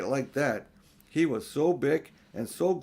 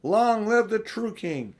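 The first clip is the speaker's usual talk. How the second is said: slow, much higher and louder joyful cry; cried out; 9 semitones higher, 8 dB louder, slower than usual. Acted. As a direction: ring it out like a king's herald over the snow. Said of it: "Then he cried out".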